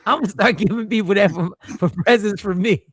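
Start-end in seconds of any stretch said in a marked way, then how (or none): none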